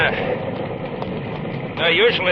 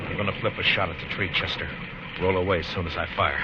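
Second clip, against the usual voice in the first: hushed orders; 9 semitones lower, 6 dB quieter than usual. Acted. Low orders under fire.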